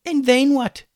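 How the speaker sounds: high, loud retort; exasperated high-pitched question; sceptical challenge. A narrator's reading. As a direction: challenge the plan sharply. Hummus challenges the plan.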